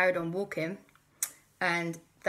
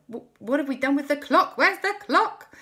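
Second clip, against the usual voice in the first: panic; 9 semitones higher, 9 dB louder than usual. Acted. Chased by raptors, panicking.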